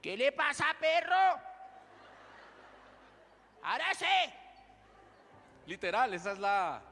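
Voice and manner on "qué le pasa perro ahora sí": loud, high Bogotá street challenge; Bogotá street tough. Pretend. Pastor doing the Bogotá version of Abisai, in a street voice. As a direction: square up like a Bogotá tough guy. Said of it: "a lo bogotano".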